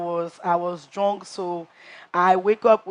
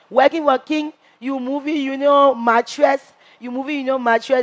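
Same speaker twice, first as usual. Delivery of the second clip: higher, louder jeering taunt; mock accusing housemate; 6 semitones higher, 4 dB louder than usual. Housemate retelling a rival's taunt, higher and jeering. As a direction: throw the insult back at her, mocking. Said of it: "So he was like".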